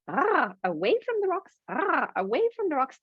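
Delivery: high squawky parrot voice. Acted. Parrot echoing Captain Vic's words, in a high squawk. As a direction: squawk the echo like a pirate's parrot. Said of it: "the parrot goes".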